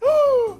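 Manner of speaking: high, sharp theatrical gasp; mock gasp. Picnicker seeing a mouse, hammed-up gasp. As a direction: gasp in pretend shock.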